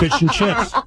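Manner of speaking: high, laughing imitation of a British accent; a teasing hint of British; mock British accent. Host repeats Eric's attempt back, amused mock British accent.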